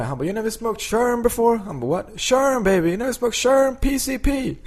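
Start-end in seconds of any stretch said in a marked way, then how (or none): 0.2-1.6 very high-pitched comic impression; Tracy Morgan. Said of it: "Tracy röker weed". 1.9-4.5 very high-pitched comic impression, climbing; Tracy Morgan. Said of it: "Tracy röker weed".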